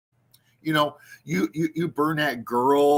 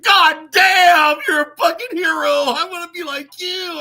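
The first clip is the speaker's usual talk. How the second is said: high, loud, gushing mock admirer; mock fanboy; 11 semitones higher, 7 dB louder than usual. Mocking Canadians admiring Michael, high gushing fanboy. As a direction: ham up a starstruck Canadian fan. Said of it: "And they're like".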